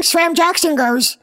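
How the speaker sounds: ordinary delivery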